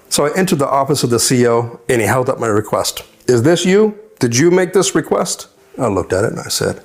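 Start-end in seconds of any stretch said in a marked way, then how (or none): 3.3-5.5 higher-pitched retold challenge; questioning officer. Commanding officer questioning an inmate's request, high-pitched challenge. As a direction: confront him, pitch raised in disbelief.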